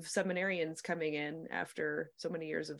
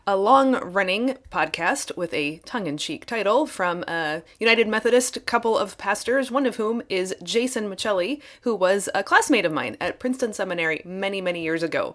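upbeat, louder announcer read over music; a lively host opening the show; podcast host intro; 4 semitones higher, 12 dB louder than usual. Acted. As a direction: introduce the episode to your listeners. Host's recorded intro to bonus episode.

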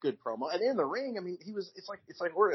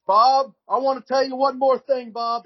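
high, loud, halting mock promo voice; awful wrestler promo; 12 semitones higher, 10 dB louder than usual. Mocking Doctor Death's rambling promo to Dusty, high and loud. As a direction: ham up a rambling tongue-tied wrestling promo, much higher and louder. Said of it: "He said".

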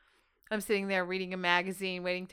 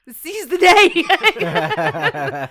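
laughing it out loud; 10 semitones higher, 14 dB louder, much slower than usual. Real, not acted. To her co-hosts, laughing loudly. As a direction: burst out laughing through the words. [name] laughing through motto.

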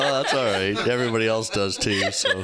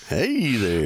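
[name] voice. Acted. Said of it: "I've been [name]".

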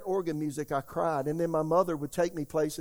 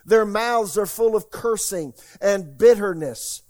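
preaching scripture; 4 semitones higher, 7 dB louder than usual. Acted. Pastor reading Romans to the church, preaching loudly. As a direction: thunder the verses at the congregation.

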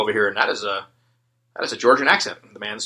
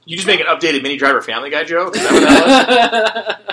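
high, loud, slowed laughing question; laughing disbelief; 6 semitones higher, 8 dB louder than usual. Real, not acted. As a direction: stretch it out through laughter. Incredulous at the joke.

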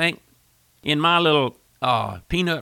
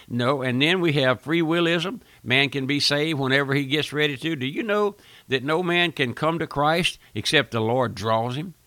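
ordinary delivery

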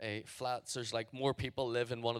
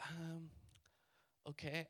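small, higher, hesitant voice; quiet panic; 6 semitones higher, 8 dB quieter, slower than usual. Retold or imitated. Agreeing to pay the CD seller, quietly panicked. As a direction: give in softly after a nervous pause. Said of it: "because I'm already, like, panicking".

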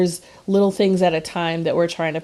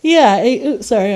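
talking through a yawn; mid-yawn, stretched words; 4 semitones higher, 6 dB louder than usual. Real, not acted. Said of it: "I'm yawning".

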